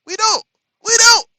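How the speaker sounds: high, loud insistence; emphatic shout. Real, not acted. Insisting on no insults.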